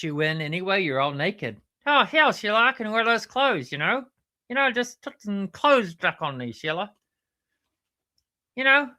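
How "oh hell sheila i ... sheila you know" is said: high-pitched puppet platypus, indignant; exasperated platypus character. Pretend. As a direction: rant in a high cartoon platypus voice. Platypus argues about clothes.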